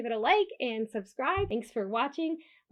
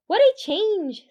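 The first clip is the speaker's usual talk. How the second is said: excited exclamation; 9 semitones higher, 9 dB louder than usual. Acted. Deer exclaims at new snowy place.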